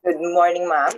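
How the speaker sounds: ordinary talk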